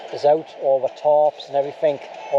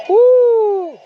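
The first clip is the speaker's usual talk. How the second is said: a long, high, loud whoop; 8 dB louder than usual. Real, not acted. Cheering his mate's Irish dance with a loud whoop.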